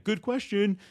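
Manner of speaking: high-pitched parody of a stock reply; mock pondering. Guest facing a repeat question, mock surprised and stalling. Mimics what not to say.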